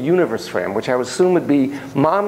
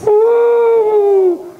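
high, loud cheer; excited woo; 6 dB louder than usual. Real, not acted. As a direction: whoop with delight at the trick. Cheering child's play.

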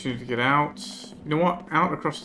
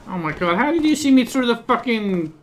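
higher, louder exasperated shout; frustrated yelling; 9 semitones higher, 7 dB louder than usual. Real, not acted. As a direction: yell at the game in disbelief. Guard spots him, outburst at game.

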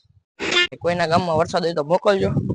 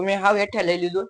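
ordinary talk

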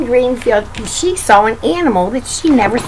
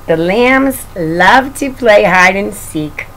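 ordinary delivery

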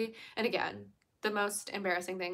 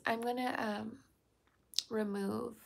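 ordinary talk

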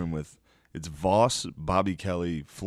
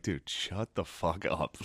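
muttered aside; 6 dB quieter, much slower than usual. Retold or imitated. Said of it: "I was sitting there like".

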